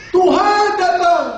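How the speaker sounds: high, slow heralding call; drawn-out cry. Acted. Repeats the midnight cry. (seruan tinggi dan panjang)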